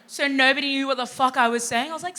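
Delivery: usual speaking voice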